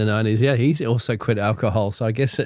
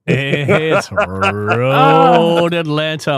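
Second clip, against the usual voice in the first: drawn-out announcement; 3 semitones higher, 4 dB louder, much slower than usual. Real, not acted. Announcing track reveal.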